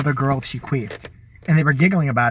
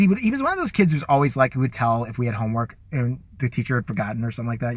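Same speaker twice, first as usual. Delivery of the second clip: usual speaking voice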